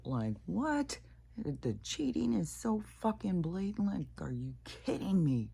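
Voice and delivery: like you can't believe your eyes; incredulous outburst. Real, not acted. Outraged at blatant cheating.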